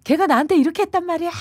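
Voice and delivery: high, fast, loud retold yell; child yelling. Child shouting at his mother, loud and high. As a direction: yell it out like a hurt kid. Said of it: "이렇게 소리를 지르면는데".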